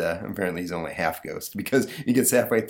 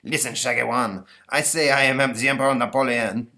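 put-on French accent; 4 semitones higher, 3 dB louder than usual. Napoleon insists on his title.

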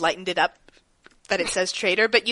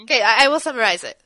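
ordinary talk

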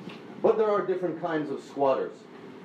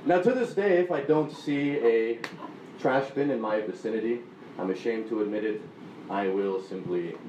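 ordinary delivery

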